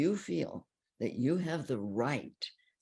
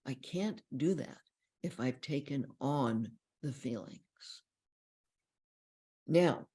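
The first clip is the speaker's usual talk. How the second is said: ordinary talk, nothing marked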